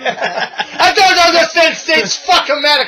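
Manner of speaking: Iron Sheik impression. Imitating the Sheik, laughing.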